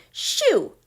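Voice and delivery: high, loud shooing yell. A narrator's reading. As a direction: yell to scare the wolf off. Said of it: "Then Kip yelled".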